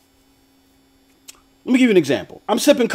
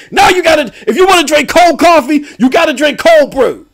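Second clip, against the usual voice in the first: mock decree; 6 semitones higher than usual. Starbucks decree, imagined.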